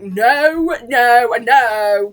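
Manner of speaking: loud, drawn-out retold no; slow, emphatic mock refusal; firm refusal. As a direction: refuse each time emphatically, imitating her. Acting out character's firm refusal.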